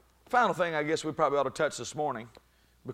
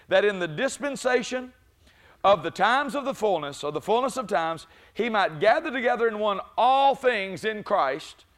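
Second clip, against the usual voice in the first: preacher rising to a shouting peak; preaching at full force; 7 semitones higher, 8 dB louder than usual. Acted. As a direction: lift the church with each phrase.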